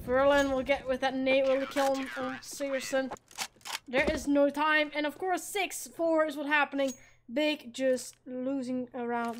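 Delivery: brisk esports caster calling the round; live play-by-play. Acted. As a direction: call the action as it happens. Casting kills live.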